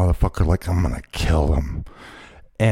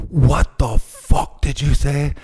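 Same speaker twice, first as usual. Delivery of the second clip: slow, higher mock confrontation; retold challenge; 5 semitones higher, slower than usual. Retelling how he confronted the stranger, mock menace. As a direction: drag it out like a threat. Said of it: "I go".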